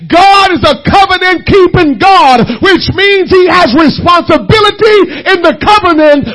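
shouted pulpit exhortation; full-force preaching. Real, not acted. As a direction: preach it hard, hammering the key words.

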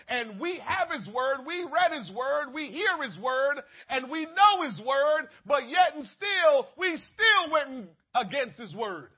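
mounting pulpit cadence; preaching at full force. Acted. Preacher builds to a climax.

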